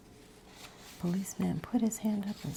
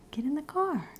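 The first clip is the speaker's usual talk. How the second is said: "Get in the car" said aloud and higher, not whispered; voiced father's call; 8 semitones higher than usual. Shown as a demonstration. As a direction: call it out like the dad really said it. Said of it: "He said".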